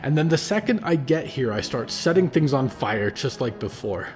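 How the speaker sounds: put-on a furious young man venting his pain; rising anger. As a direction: let the rage boil over, then grind it out. Percy rages at the cult.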